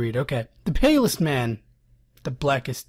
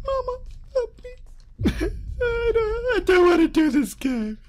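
high, shaky pleading with cries; terrified crying; 5 dB louder than usual. Real, not acted. Player begging his mother while being hunted, crying.

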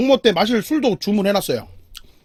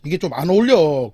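ordinary talk

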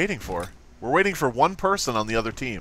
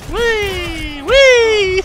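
high wee, second one much louder; gleeful squeal; 9 dB louder than usual. Real, not acted. Gamer playing around, high gleeful squeals. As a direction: squeal wee twice, building to loud.

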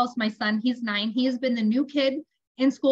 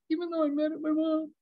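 quick, high, sulky little voice; grudging child's repetition; 5 semitones higher, 4 dB quieter, faster than usual. Retold or imitated. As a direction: imitate a kid reluctantly parroting mom. Sulky son repeats mother's phrase.

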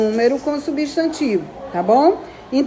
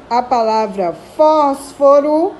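ordinary talk, nothing marked

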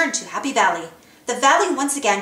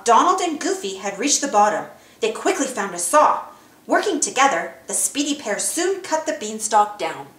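plain reading voice

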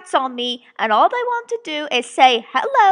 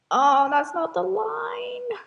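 long, raised groan of self-annoyance; drawn-out exasperation; 5 semitones higher, 3 dB quieter, slower than usual. Real, not acted. Narrator fluffing own line, exasperated. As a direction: stretch it out slowly, higher, fed up.

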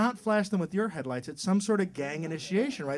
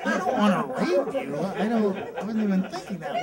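flustered mugger. Acted.

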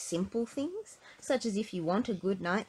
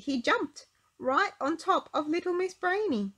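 ordinary delivery

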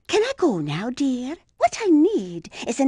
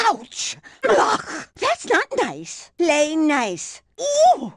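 ordinary talk

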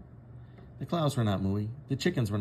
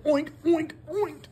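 much higher squealing oink; oinking pigs; 3 dB louder, slower than usual. Acted. Teacher voicing excited pigs, oinking. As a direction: oink like a piglet.

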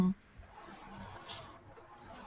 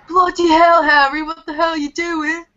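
put-on British boy; 7 semitones higher, 7 dB louder than usual. Acting a Harry Potter scene.

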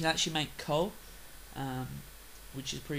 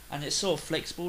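normal talking voice

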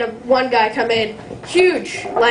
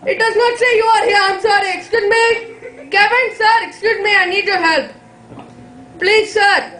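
higher, pleading mock panic with laughter; hammed-up helpless plea; panicked lost man; 7 semitones higher than usual. Lost person begging for help.

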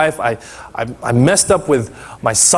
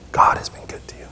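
unvoiced whisper of the line; hushed repetition of the promise; whispered echo; 7 dB quieter, faster than usual. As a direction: whisper it as a private reassurance. Repeating the psalm line softly.

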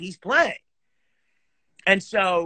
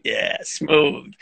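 breathy, hushed pleased-with-himself murmur; mock cool, congratulating himself; smug self-praise; 6 semitones lower, much slower than usual. Musician after his recital, privately smug. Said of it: "and I was like".

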